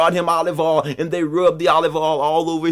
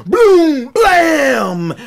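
high, loud exploding boom; booming explosion noise; 10 semitones higher, 7 dB louder than usual. A narrator's reading. Storyteller sounding the pot's explosion, booming noise. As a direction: belt out the blast, stretched.

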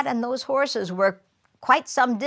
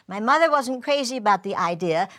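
ordinary talk, nothing marked

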